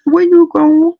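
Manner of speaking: calling out. Retold or imitated. Calling to the boy as he heads off, high and loud. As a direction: sing out to him as he leaves.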